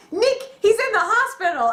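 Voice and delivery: high, rushed bad news; panicky Larry. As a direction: friend blurting out the news. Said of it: "just like".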